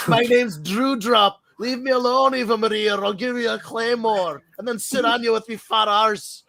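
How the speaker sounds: high, hammed-up Scottish brogue; mock Scottish threat, pitched well up; Drew. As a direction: play a silly Scottish wrestler making threats. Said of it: "That's like if Drew was".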